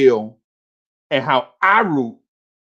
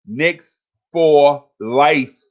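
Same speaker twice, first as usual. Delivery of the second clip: drawn out; much slower than usual. Real, not acted. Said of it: "hyping chat".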